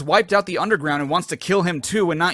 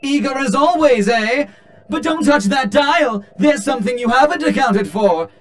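hammy showman robot; 6 semitones higher, 4 dB louder than usual. Pretend. Showman robot character voiced.

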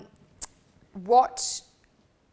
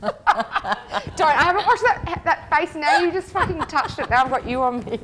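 high and loud, laughing through the words; laughing protest; 8 semitones higher, 8 dB louder than usual. Real, not acted. Host protesting to the guest through laughter, squealing.

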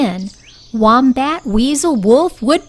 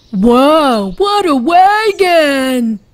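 Wolf's voice; 3 semitones higher, 4 dB louder, slower than usual. Acted. Wolf voiced in story.

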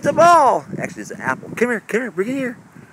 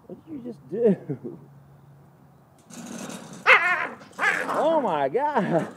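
high, disbelieving, last words slowed; stunned exclamation at the dog; shocked; 10 semitones higher than usual. Real, not acted. Shocked at dog's act.

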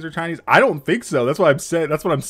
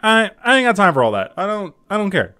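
higher, louder, fed-up protest; fed-up dismissal; 7 semitones higher, 5 dB louder than usual. Retold or imitated. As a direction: wave it off, raised voice. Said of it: "I'm just like".

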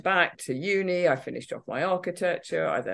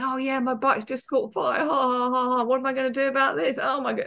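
higher, carefree mock laugh at her own mishap; 9 semitones higher than usual. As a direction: play her breezy young self shrugging off the fire. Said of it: "I was almost just kind of laughing about it".

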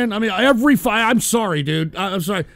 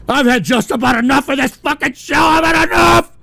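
fed-up shout; 4 semitones higher, 5 dB louder than usual. Acted. Staged rage rant.

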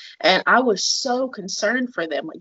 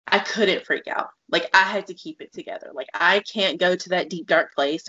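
ordinary delivery

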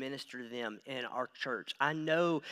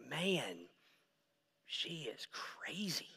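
unvoiced whisper, slow and wary; hushed aside; 10 dB quieter, much slower than usual. The same onlooker whispering a warning, now wary.

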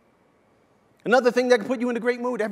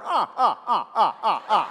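imitated bird cackle in separate bursts; mock the dogs with repeated falling "ah"; parrot's mocking laugh. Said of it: "bird's looking at them going".

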